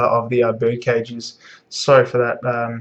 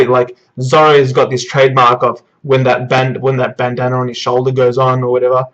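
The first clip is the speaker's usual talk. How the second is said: ordinary talk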